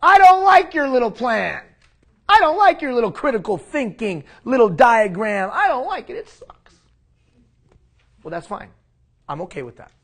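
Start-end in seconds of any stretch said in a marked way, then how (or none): 0.0-6.1 much higher, louder whiny mock complaint; mocking complainer. Scene: imagined viewer mocking the speaker's plan, high whiny complaint. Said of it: "afraid to say it in front of me". 6.1-6.8 whispered sulky aside; mock whisper. Scene: imagined critic ending the complaint, whispered sulk. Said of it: "afraid to say it in front of me".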